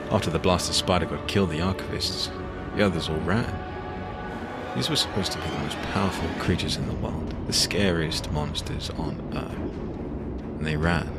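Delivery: plain reading voice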